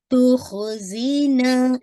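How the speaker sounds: तो खुजीना slowly with a heavy kha; slow recitation demo. Shown as a demonstration. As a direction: stretch the ना for two counts. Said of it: "ना को again आपने टू count".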